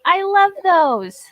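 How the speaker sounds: high, loud, slow gushing. Real, not acted. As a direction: coo with delight. Gushing over earrings.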